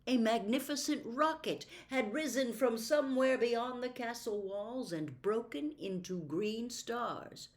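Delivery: ordinary delivery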